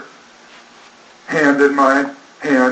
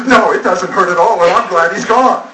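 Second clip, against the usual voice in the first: higher and louder, hard defiant denial; fatherless teen girl; 7 semitones higher, 5 dB louder than usual. Retold or imitated. Teen girl denying her absent father hurts her, defiant and higher. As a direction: brush off the hurt like a tough fifteen-year-old. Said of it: "The tone of a fatherless fifteen-year-old girl".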